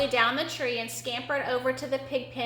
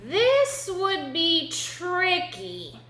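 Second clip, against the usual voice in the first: very slow, raised-pitch suspense; slow, high storyteller's warning; dramatic drawn-out; 6 semitones higher, much slower than usual. A narrator's reading. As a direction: stretch out THIS and TRICKY dramatically. First, dramatic take of repeated line.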